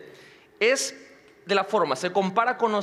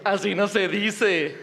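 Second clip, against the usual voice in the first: amused correction; slower than usual. Acted. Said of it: "Y nos da risa porque decimos".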